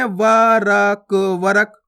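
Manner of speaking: वरक drawn out slowly as "vaa-raa-k", then "varak"; full "ra" sound. Shown as a demonstration. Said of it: "पूरा रा का उच्चारण हो जाएगा".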